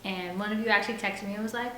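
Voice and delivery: plain reading voice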